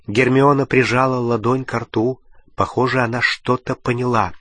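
ordinary delivery

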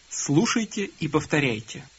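usual speaking voice

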